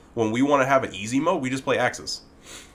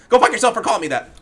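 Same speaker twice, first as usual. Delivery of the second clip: mocking imitation; 12 semitones higher, 7 dB louder, faster than usual. Re-quoting moderator's rude reply.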